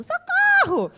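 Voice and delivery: very high, loud cartoonish cry for help; mock scream for help. Kidnapped girl cries out as the earth opens, mock scream. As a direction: shriek it like a hammed-up damsel. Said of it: "a burra vai gritando".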